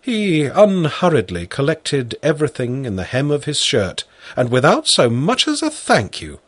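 plain reading voice

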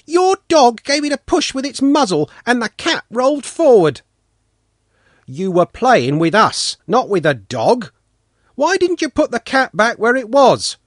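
put-on high indignant boy's complaint. A narrator's reading. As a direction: argue the point hotly. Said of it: "Sanka cried".

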